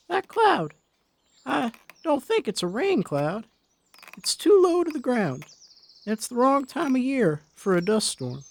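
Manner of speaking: put-on high frail old woman, growing alarm; thin high voice, anxious about the cloud; worried old Molly. Wife describes the odd cloud to husband, high and worried.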